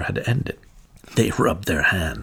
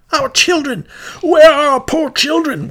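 high, loud anguished cry; desperate cry; 10 dB louder than usual. A narrator's reading. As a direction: cry out for the lost children. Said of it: "was the cry".

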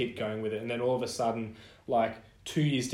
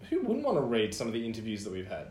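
ordinary delivery

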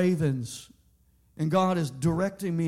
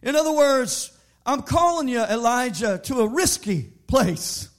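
preaching; 3 semitones higher, 6 dB louder than usual. Acted. Preaching at full force.

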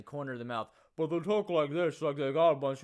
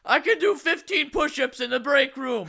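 bellowed, much higher and louder mock boss bragging; mock big-shot boss; 13 semitones higher, 18 dB louder than usual. Coworker hams up being the boss, shouting. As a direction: puff up like a cocky office boss and shout it. Mock bossy boast about himself.

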